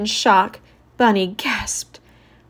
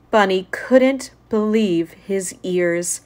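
ordinary delivery, nothing marked

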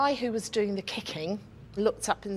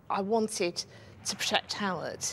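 ordinary talk, nothing marked

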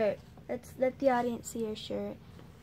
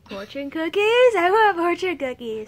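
excited; 6 semitones higher, 10 dB louder than usual. Real, not acted. Excited craving for cookies.

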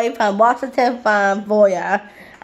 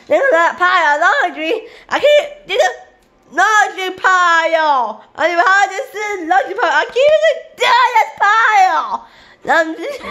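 laughing exasperation; 8 semitones higher than usual. Real, not acted. Overwhelmed by laundry pile.

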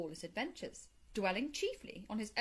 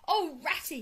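higher, louder exclamation to a friend; crying out; 6 semitones higher, 5 dB louder than usual. A narrator's reading. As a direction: cry out to Ratty, pitched up. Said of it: "he cried".

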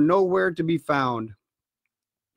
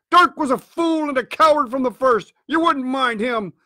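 higher, heated dismissal; scornful berating; 7 semitones higher than usual. A narrator's reading. Blind man berates his fearful crew, scornful raised voice. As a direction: scold the cowards.

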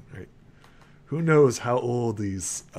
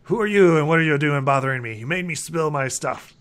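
louder, accusing outburst in character; irritated alchemist; 3 semitones higher, 4 dB louder than usual. Acted. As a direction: play an annoyed alchemist blaming the intruder. Disturbed alchemist snaps at visitor.

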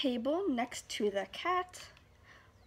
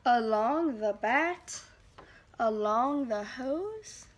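slow recital; 5 dB louder, slower than usual. Shown as a demonstration.

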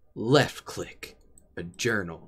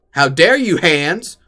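mock outrage; 4 semitones higher, 7 dB louder, slower than usual. Mock outrage at own hands.

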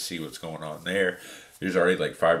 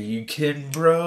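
shocked disbelief; 9 semitones higher, 8 dB louder than usual. Retold or imitated. Shocked reaction to prank.

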